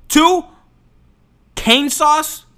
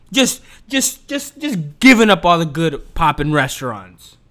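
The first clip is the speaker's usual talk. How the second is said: normal talking voice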